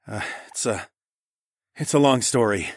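sighed. A narrator's reading. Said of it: "I sighed".

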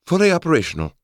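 put-on very high, bright girlish voice; Holly voice. A narrator's reading. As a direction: pitch way up, chirpy. Holly character voice.

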